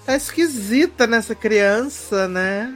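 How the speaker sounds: sweet mother voice. Retold or imitated. Julia Stiles voiced adopting.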